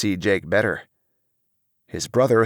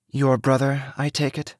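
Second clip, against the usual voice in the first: put-on higher-pitched, lightly haughty young woman; soft yet haughty; 6 semitones higher than usual. A narrator's reading. Young woman asks about his brother, soft yet haughty. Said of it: "in a soft yet haughty voice".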